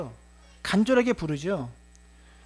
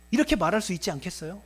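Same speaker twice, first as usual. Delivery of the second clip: ordinary talk, nothing marked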